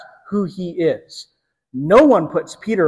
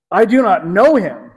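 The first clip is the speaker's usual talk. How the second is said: louder, emphatic retold denial; forceful denial; 3 semitones higher, 5 dB louder than usual. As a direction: deny it firmly and loudly, as Peter. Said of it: "very forcefully, Peter denies it".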